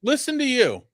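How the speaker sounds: high, mocking sing-song. Taunting his cohost in a high mocking voice. Mocking back-and-forth jab.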